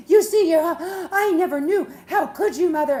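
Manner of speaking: loud, high mock wailing in court; hammed-up crying outburst, high and very loud; hysterical daughter. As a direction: wail it like a betrayed daughter. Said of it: "the daughter goes up".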